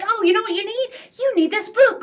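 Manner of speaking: bright, eager pitch-up pushing a book; overeager advice. Retold or imitated. Coach gushing a book at a complaining friend, overeager. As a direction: gush about the book you want them to read.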